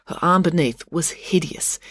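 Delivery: ordinary delivery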